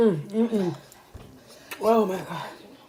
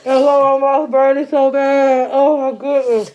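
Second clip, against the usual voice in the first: excited; 4 semitones higher, 5 dB louder than usual. Real, not acted. Mouth burning after prank.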